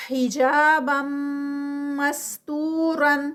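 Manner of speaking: slow, held مَسْتُورًا with a stretched و; Quranic recitation. Shown as a demonstration. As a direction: chant it slowly as a tajweed drill. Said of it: "میم کا غنہ".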